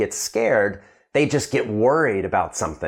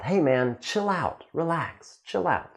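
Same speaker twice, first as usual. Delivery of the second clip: higher, softer, easygoing order to relax; laid-back urging; 5 semitones higher, 4 dB quieter than usual. Acted. Teacher acting out telling someone to chill out, laid-back. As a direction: tell a buddy to chill, lightly and higher. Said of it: "you can even order someone to chill out".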